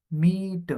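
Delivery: મીટ with the "ee" drawn out; long, stressed vowel. Shown as a demonstration. Teacher demonstrates two vowels side by side, મીટ with a lengthened "ee". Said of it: "લાંબો ઉચ્ચાર એટલે એના ઉપર થોડો ભાર મૂકવાનો".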